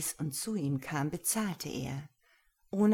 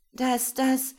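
much higher, louder stammer; stuttering girl; 8 semitones higher, 7 dB louder than usual. Acted. Lena stammers before Gero, high. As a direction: stammer nervously.

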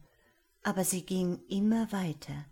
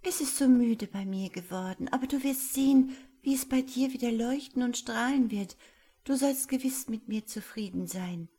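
higher, eager angel voice pressing on; pleading little angel; 6 semitones higher, 4 dB louder than usual. Acted. Angel urges the wizard to accept her heart, high and eager. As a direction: persuade him with hopeful urgency.

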